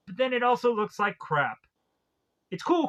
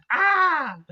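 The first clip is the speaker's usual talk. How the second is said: mock shriek; 8 semitones higher, 5 dB louder than usual. Laughing reaction after the lady.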